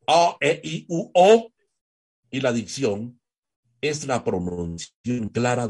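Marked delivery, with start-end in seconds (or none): 0.1-1.5 "A, E, I, U, O" high and loud, each one distinct; shaped vowels. Shown as a demonstration. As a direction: give each of "A, E, I, U, O" its full mouth shape. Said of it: "dándole la figura a las vocales". ("A, E, I, U, O" en voz alta y aguda, bien moldeadas)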